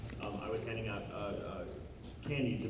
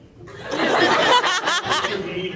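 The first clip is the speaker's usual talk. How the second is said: loud high goofy cackle; the laugh you do for the camera; 10 dB louder than usual. Shown as a demonstration. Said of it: "my first impression is to go like".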